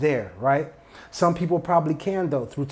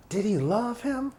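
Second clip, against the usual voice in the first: Did he love him raised high and softly, him lifted; rising question; 6 semitones higher, 3 dB quieter than usual. Shown as a demonstration. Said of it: "repeated question".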